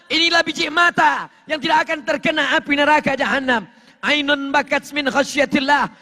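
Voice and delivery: high, forceful proclamation; full-force preaching. Real, not acted. Preacher telling of eyes spared hellfire, full-force preaching. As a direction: drive the teaching out at a high pitch.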